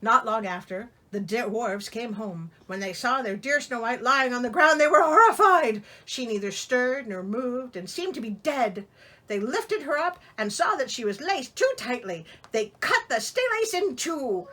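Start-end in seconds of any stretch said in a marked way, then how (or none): none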